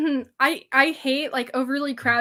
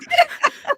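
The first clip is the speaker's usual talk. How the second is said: high laugh; 4 dB louder than usual. Real, not acted. Laughing at own joke.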